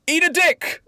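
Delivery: high, loud theatrical insult; William Shakespeare. Acted. Playwright mocks the pumpkin boy, high loud shout. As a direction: hurl it out high and punchy. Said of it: "William Shakespeare says".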